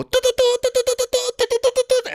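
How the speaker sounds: high rapid ticking printer sound; printer ticking noise. Retold or imitated. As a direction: imitate tickets spitting out, high.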